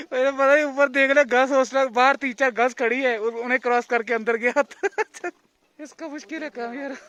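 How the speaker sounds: cracking up at his friend's blunder; laughing while telling. Real, not acted. To the viewers, about Ayush entering the girls' hostel, laughing hard.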